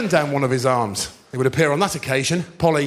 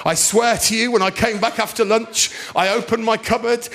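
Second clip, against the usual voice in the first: ordinary talk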